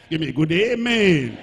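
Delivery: loud call to the congregation; calling for amen. Real, not acted. Preacher to the church, loud call for amen.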